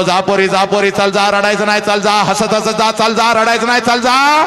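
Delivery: cheerful send-off that keeps climbing; father laughing her off. Acted. Father sends daughter off, mock-cheerful climbing voice. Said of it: "हसत हसत तुला म्हणतो".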